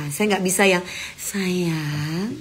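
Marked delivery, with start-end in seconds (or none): 1.1-2.3 mock gentle talk. Shown as a demonstration. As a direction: pretend to be gently coaxing, not your real manner. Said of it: "itu nggak bisa ya". (pura-pura lembut)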